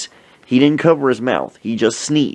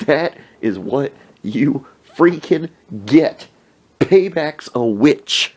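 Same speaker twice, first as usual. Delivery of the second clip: slow, higher, word-by-word scolding; emphatic gloating; 4 semitones higher, slower than usual. Retold or imitated. Telling drenched friend he deserved it, slow emphatic gloating. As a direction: spell it out, savoring each word.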